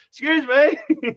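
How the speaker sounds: very high, slow mock-polite call; drawn-out imitation of meek courtesy; exaggerated politeness. Mimicking a friend's polite approach to a stranger, exaggerated courtesy. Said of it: "he was so polite".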